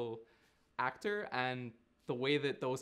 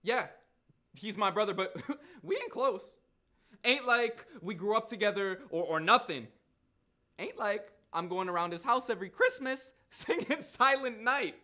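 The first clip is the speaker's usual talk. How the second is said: higher, animated street-talking character; Nigel, defensive storyteller; 9 semitones higher, 7 dB louder than usual. Acted. Nigel explains he and his brother ain't close, defensive. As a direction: play a man disowning his brother, agitated.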